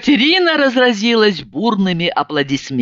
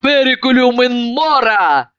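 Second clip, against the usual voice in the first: barked command; 5 semitones higher than usual. A narrator's reading. Drunk friend urges everyone to drink, barking. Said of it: "Иванова рявкнула".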